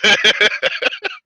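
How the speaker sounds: loud, high burst of laughter; laughing. Real, not acted. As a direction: crack up at your own joke. Laughing at own Texas heat joke.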